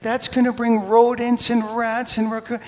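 worked-up comic complaint; irritated rant. Retold or imitated. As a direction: rant with mock outrage. Retelling his irritation at lady.

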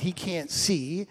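ordinary talk

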